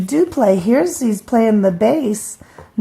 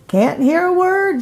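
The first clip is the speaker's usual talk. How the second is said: mock scream; 9 semitones higher than usual. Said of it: "screamed Melissa".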